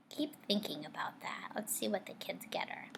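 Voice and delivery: soft conspiratorial whisper; whispered aside. Real, not acted. Teacher whispering a teaser to the class before reading on. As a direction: whisper it to draw them in. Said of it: "teacher aside to children".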